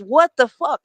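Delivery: friend's stunned "what the fuck"; shocked outburst. Retold or imitated. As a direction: blurt it like the news just hit you. Friend's shocked reaction, retold.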